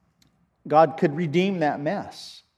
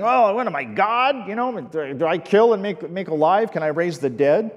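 higher, louder mock whine; whining king; 4 semitones higher, 3 dB louder than usual. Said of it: "starts whining".

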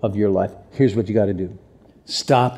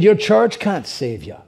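put-on higher-pitched Irish accent; 8 semitones higher than usual. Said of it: "why am I still in the accent?".

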